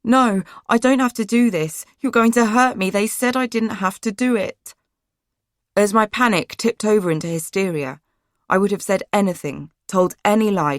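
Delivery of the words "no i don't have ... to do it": panicked shout. A narrator's reading. Girl panicking as the door closes, shouting. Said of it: "I began to shout".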